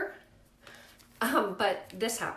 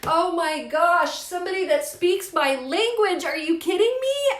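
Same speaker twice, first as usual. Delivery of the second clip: overjoyed relief; 9 semitones higher, 12 dB louder than usual. Retold or imitated. Mother thrilled a friend agrees with her, loud gushing joy. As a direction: sound like you finally found an ally. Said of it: "I was so happy to hear".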